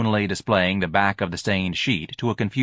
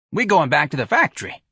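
raised, indignant announcement; angry; 7 semitones higher, 4 dB louder than usual. Acted. Intense lady speaking for the choir to Ignatius, angry. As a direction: announce it crossly, pitched up. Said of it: "said angrily to Ignatius".

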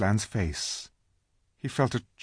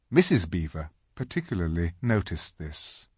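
ordinary delivery

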